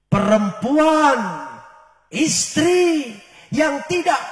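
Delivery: loud, slow climactic sermon; preacher's loud climactic proclamation; preaching at full force. Real, not acted. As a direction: drive the lesson home with force.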